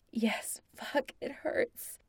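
high, slow whispered pain. Acted. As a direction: gasp it out hurting. Ryan in pain after the blow.

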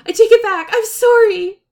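high, loud, frantic take-back; panicked apology. Acted. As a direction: blurt the apology in a flustered squeal. Panicked apology to crying friend.

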